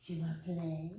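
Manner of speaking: quiet coaxing question; soft teasing invite. Shown as a demonstration. Trainer softly teasing her dog into tug. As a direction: murmur it low and soft to tease the dog. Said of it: "I always start a tug game with Ben by going".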